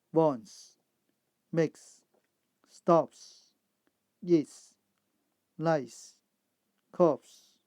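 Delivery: loud single words, each closing on a hissed "s"; S endings. Shown as a demonstration. Teacher modelling plurals after voiceless sounds, hissed S endings. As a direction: list words one by one, crisp final S. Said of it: "are pronounced as s".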